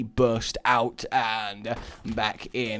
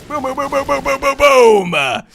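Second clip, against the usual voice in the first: high, loud chant of booms; booming along with the fireworks; mock explosions; 13 semitones higher, 11 dB louder than usual. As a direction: fire off each boom like a rocket.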